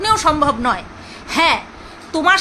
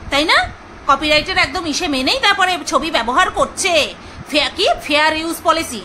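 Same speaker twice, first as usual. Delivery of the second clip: ordinary delivery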